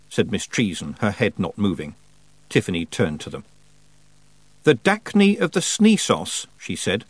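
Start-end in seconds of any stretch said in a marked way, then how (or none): 4.6-6.5 high, slow puzzled reading; puzzled girl reading. A narrator's reading. Tiffany reading the misspelt title aloud, puzzled and slow. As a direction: sound out the odd title slowly.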